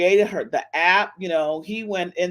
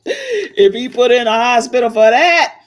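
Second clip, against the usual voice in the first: laughing; 3 semitones higher, 9 dB louder than usual. Real, not acted. Laughing disbelief.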